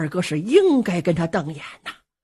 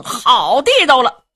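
plain reading voice

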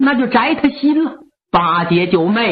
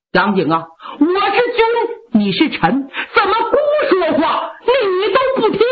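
higher, rising indignant royal voice; angry prince; 6 semitones higher than usual. Acted. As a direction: pull rank, offended. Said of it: "赵德芳生气了".

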